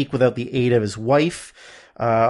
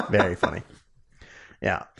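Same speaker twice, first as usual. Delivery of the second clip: ordinary talk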